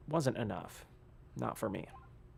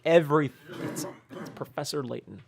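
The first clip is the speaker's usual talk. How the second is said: normal talking voice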